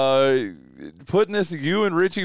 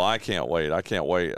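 joking; 11 semitones lower, 4 dB quieter than usual. Acted. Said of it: "I was just joking about that".

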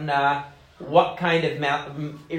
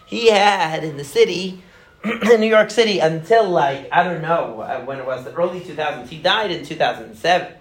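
ordinary delivery

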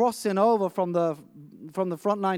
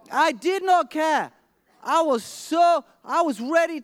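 intense; 6 semitones higher than usual. Retold or imitated. Panicked father reliving the search.

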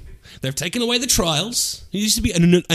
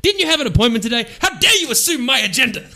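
high, quick outraged retort; indignant; 7 semitones higher than usual. Shown as a demonstration. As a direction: snap back in mock offense. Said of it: "you did it wrong".

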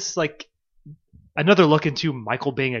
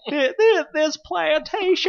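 high, drawn-out, botched Southern drawl; badly faked Southern accent; 13 semitones higher, slower than usual. Quoting Oscar delivering bad news, terrible Southern accent. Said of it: "say it in a Southern accent".

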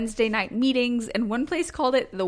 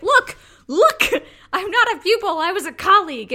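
insistent; 9 semitones higher, 7 dB louder than usual. Retold or imitated. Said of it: "And he was like".

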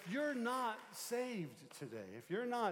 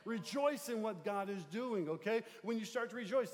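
ordinary talk, nothing marked

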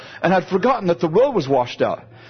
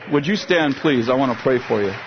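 preaching prayer; 3 semitones higher than usual. Acted. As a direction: lead the congregation in heartfelt prayer. Pastor prays over congregation.

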